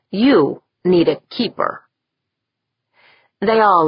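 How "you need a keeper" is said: ordinary delivery, nothing marked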